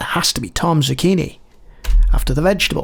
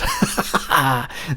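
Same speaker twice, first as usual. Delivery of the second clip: quick run of chuckles; laugh; 3 semitones lower, 4 dB quieter than usual. Real, not acted. Player amused by the old mage's name, laughing. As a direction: break into a short laugh.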